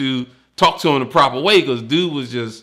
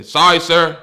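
louder, higher clipped "Sorry, sir."; loud forced apology; 6 semitones higher, 9 dB louder than usual. Retold or imitated. Recruit answering the lieutenant, loud dutiful apology. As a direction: sound off like a recruit.

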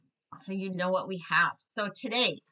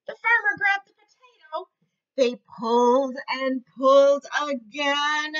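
lively storybook voice for little ones; reading to children; 5 semitones higher, 5 dB louder than usual. A narrator's reading. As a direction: read it like a teacher at story time. Reads picture book to kids.

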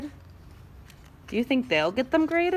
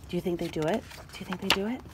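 ordinary delivery

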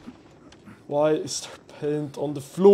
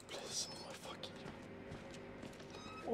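terrified whisper; 28 dB quieter than usual. Real, not acted. Gamer whispering a terrified plea.

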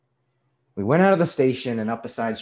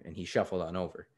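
ordinary delivery, nothing marked

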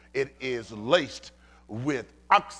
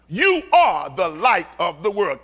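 loud, forceful proclamation; emphatic, from the chest; 6 semitones higher, 12 dB louder than usual. Acted. Preacher voicing Jesus to the congregation, emphatic chest-voiced shout. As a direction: say it with your chest. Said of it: "He said it with his chest".

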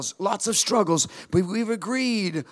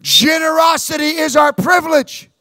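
"Generosity is our privilege" shouted high; rally everyone to repeat it; call-and-response shout; shouted declaration; 9 semitones higher, 8 dB louder than usual. Shown as a demonstration. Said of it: "Say it with me".

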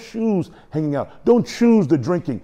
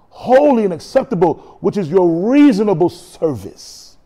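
raised, impassioned proclamation; forceful preaching; 5 semitones higher, 4 dB louder than usual. Acted. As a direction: preach the verse with full force. Preaches scripture at full force.

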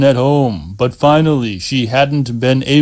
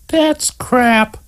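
put-on high girl's voice, mildly angry; 11 semitones higher than usual. A narrator's reading. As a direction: brush off his self-pity sharply. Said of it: "angry at his putting himself down".

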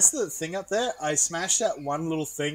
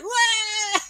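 high mock wail; cartoon shout; 5 dB louder than usual. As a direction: yelp like falling. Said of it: "And it's like".

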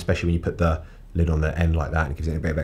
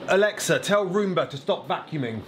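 high-pitched command to a smart speaker; high, sing-out voice command; calling a voice assistant; 9 semitones higher than usual. Real, not acted. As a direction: call out high to the robot helper.

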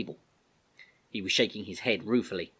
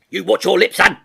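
loud, raised sergeant's reprimand; sharp rebuke; 10 semitones higher, 15 dB louder than usual. Acted. Sergeant telling off a young soldier for cheek, sharp. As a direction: cut him off sharply and loudly. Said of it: "said Sergeant Thunder sharply".

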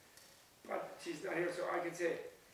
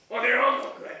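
loud, raised-pitch mock rant; Ralph Nader; 4 semitones higher, 13 dB louder than usual. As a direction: burst into an imitated rant. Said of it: "he goes ranting".

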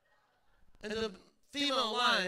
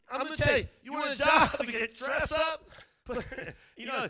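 normal talking voice

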